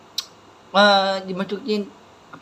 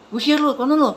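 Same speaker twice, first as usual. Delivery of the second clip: mock angry order, pitched higher; higher-pitched retold scolding; 6 semitones higher than usual. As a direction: imitate an angry father throwing someone out. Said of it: "Terus dia marah tuh".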